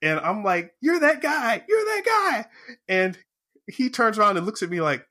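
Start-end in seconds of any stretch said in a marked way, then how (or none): 0.8-1.6 high, thrilled squeal of recognition; excited fan recognizing his idol; starstruck excited. Retold or imitated. 1.7-2.5 high, thrilled squeal of recognition; excited fan recognizing his idol; starstruck excited. Retold or imitated. Repeats louder, even higher.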